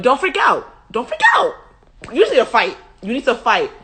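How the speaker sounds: high, loud pep talk; urgent hype-up. Acted. Philistine rallying scared soldiers who are freaking out, urgent hype-up. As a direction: urge frightened comrades into the fight. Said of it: "they're hyping themselves up".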